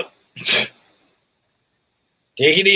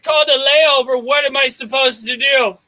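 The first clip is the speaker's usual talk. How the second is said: shouting; 6 semitones higher than usual. Acted. Shouting across the terminal.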